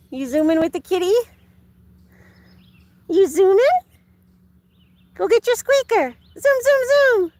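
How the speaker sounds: high, loud sing-song talk to the dog. Real, not acted. Owner urging her dog to fetch, high baby talk.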